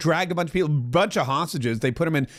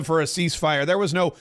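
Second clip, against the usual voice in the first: ordinary delivery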